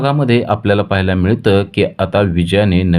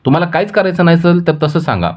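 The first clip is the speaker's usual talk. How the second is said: much higher, heated protest; angry; 9 semitones higher than usual. Acted. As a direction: throw the question back at him, fuming. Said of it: "Vijaya चिडते आणि म्हणते".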